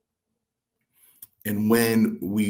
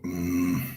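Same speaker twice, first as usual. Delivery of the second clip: irritated grunt; 4 dB quieter than usual. Retold or imitated. Wrestler mimics his irritated mentor, imitated grunt. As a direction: grunt like a mildly irritated old boss. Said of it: "when Strongbow was a little irritated".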